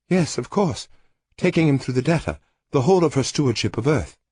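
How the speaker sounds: lightly voiced bitter knowing woman; a hint of irony; Lorinda, wry. A narrator's reading.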